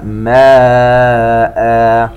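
ماء ending in a two-count ا at the stop; substitute madd. Shown as a demonstration. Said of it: "بمد العوض". (ماء وقفًا بمد العوض)

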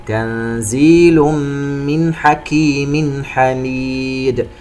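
slow chant, hummed "تنزيل من", clear n before "حكيم"; izhar and idgham. Shown as a demonstration. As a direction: chant drawn-out "حميد" after clean nasals in "حكيم". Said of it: "نون ساكنة وبعدها حرف الحاء إظهار". (ترتيل بطيء مع إظهار "من حكيم حميد")

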